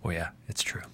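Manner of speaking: whispered catchphrase imitation; very soft, low whisper of a wrestler's tagline; Kurt Angle. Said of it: "Remember Kurt Angle".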